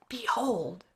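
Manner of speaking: usual speaking voice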